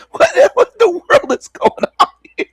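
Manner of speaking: high-pitched, laughing what in the world; cracking up in disbelief at the clip. Real, not acted. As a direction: squeak it out through laughter. Laughing at the video.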